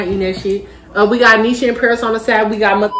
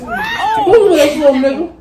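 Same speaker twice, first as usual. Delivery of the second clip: shouting; 8 semitones higher, slower than usual. Real, not acted. Shouting at the kid.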